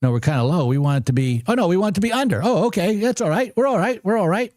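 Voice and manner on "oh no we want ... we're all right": relieved realization. Real, not acted. Realizes the trade is fine.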